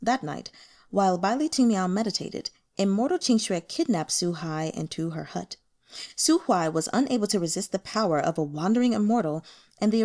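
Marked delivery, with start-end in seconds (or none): none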